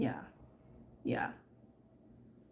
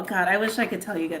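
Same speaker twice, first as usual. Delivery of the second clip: normal talking voice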